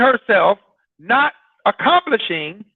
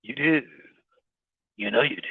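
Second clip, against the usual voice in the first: much lower, much softer knowing murmur; knowing aside; 9 semitones lower, 9 dB quieter, faster than usual. Real, not acted. Preacher quietly calling out the congregation, low and soft. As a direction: drop it low, as if letting them in on it. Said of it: "teasing the congregation".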